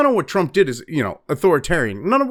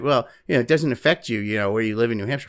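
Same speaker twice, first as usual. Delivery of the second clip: softer, quick dismissive lefty voice; mock lefty; 7 semitones lower, 4 dB quieter, faster than usual. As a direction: brush it off like a smug leftist. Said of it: "if some lefty's like".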